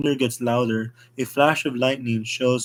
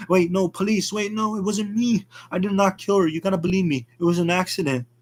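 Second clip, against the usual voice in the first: much higher, frantic denial; desperate pleading; 7 semitones higher than usual. Acted. Artie begging the police to believe him, high frantic pleading. Arrested man pleads innocence.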